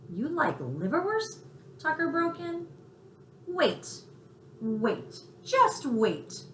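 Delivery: high, eager mouse voice; bursting with excitement; excited mouse. Acted. Tucker hears of liverwurst and cuts Chester off, high and excited.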